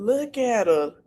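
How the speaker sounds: ordinary talk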